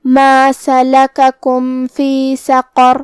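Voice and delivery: slow chant of ما سلککم فی سقر, ending سقر on heavy Q and R; heavy qaf and raa. Shown as a demonstration. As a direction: make قاف and را full in سقر, chanting slowly. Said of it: "را کو موٹی آواز میں پڑھنا ہے". (سقر میں قاف اور را موٹی آواز میں)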